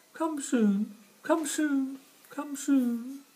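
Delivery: very high, loud squirrel voice. Acted. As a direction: squeak it like a cold little squirrel. Squirrel voice continues.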